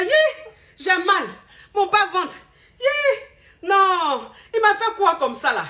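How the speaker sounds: high, laughing mock cry of pain; woman moaning in pain. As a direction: play the wife wincing, half laughing. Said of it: "Elle fait".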